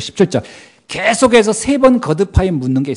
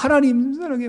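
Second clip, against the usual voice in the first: mock sentimental; 8 semitones higher, 5 dB quieter than usual. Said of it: "내가 막".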